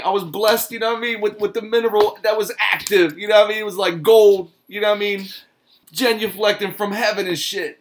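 high, loud, rambling mock Wu-Tang talk. Mocking Ghostface answering burger questions, high and loud. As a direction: ham up a mystical rapper answering plain questions. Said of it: "that's how they talk all the time".

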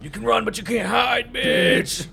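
high yelled horror-movie taunt; hammed-up slasher threat; mock taunt.

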